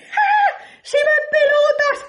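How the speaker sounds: put-on very high child's cry of discovery; child shouting. A boy blurting out that the emperor is naked, very high squeal. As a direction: squeal it out like a little kid who just noticed. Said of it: "va un niño y dice".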